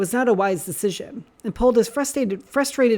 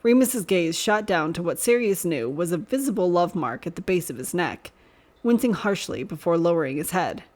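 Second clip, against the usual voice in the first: ordinary delivery, nothing marked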